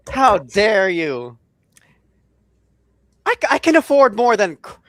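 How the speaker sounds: playfully outraged protest; mock offended. Mock offense at crack joke.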